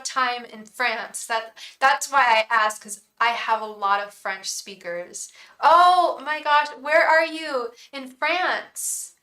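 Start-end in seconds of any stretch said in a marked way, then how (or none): 5.6-9.1 high, loud, eager question; excited surprise. Real, not acted. Streamer asks French viewer where they are, excited surprise.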